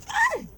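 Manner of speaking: sudden high, loud "ay!" yelp; high squeal. Real, not acted. Reader reacting to her own words, high squeal.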